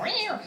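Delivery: high squeaky hiss-meow like an angry cat; a cat's meow thrown at him; mock cat meow. Arguing with her fiancé over counter space, mock cat meow.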